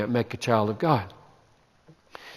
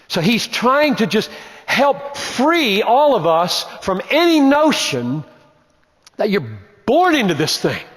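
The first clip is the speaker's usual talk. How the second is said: preaching at full force; 8 semitones higher than usual. Acted. Preacher sweeping away his congregation's false notions, full fervor. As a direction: pound through the list with mounting conviction.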